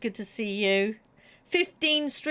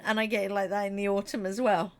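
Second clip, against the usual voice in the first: ordinary talk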